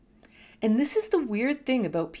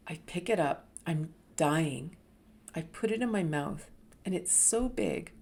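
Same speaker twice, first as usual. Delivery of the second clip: usual speaking voice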